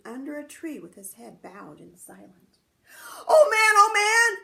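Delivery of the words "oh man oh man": high, loud calling out; shouting. Acted. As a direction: call to the old man across the distance. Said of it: "shouted Chang".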